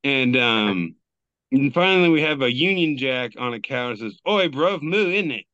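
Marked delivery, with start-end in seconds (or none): 4.3-5.4 put-on cheeky London accent, a bit louder and higher; hammed-up Cockney cow voicing a British flag; a deliberately terrible British accent; bad British accent. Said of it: "I hate that I can do that accent".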